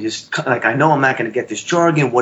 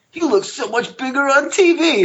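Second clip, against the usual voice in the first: high-pitched excited retelling; 10 semitones higher than usual. Retold or imitated. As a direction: pitch jumps up, reliving the excitement. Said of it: "your voice goes that high".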